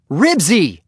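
high, loud yell at the dog. A narrator's reading. Henry yells at his dog while rescuing kittens. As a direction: yell the name to call off a dog. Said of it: "yelled Henry".

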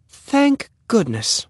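high, drawn-out sigh of relief in words; relieved mother. Acted. Mother sees the last kitten caught, slow high relief. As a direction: stretch it slowly, pitched well up. Voices relieved Missus Huggins.

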